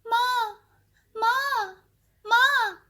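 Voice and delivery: high, loud cries of "माँ"; loud calling. A narrator's reading. Baby bird calling loudly for its mother. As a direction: call out for mother at the top of the voice. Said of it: "तो उसने जोर से पुकारा".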